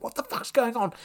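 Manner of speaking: high, incredulous outburst; can't believe where she's ended up; baffled disbelief. Retold or imitated. Surrounded by celebrities at a party, disbelieving. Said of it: "And it's like".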